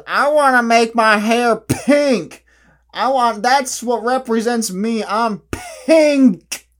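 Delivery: high, loud, slow mocking whine; mock a hair-dyer's whine; mocking whiny voice. Mocking people who dye their hair.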